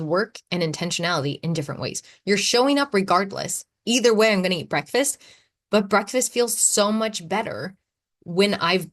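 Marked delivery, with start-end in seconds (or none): none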